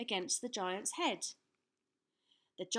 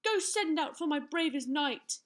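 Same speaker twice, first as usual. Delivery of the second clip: raised, high-pitched royal bellow; bellowed order; 7 semitones higher, 5 dB louder than usual. A narrator's reading. King sends for his bravest knight, bellowing. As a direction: call the order down a long hall. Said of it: "he bellowed down the hall".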